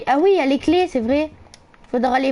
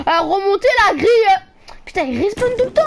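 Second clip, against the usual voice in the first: frustrated whine; 9 semitones higher, 5 dB louder than usual. Real, not acted. Frustrated at grille.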